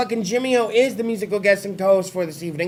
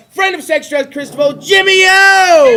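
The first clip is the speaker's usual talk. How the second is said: long, loud, high announcer call; drawn-out shouted guest intro; hype introduction; 8 semitones higher, 7 dB louder, slower than usual. Acted. Host introduces guest to crowd.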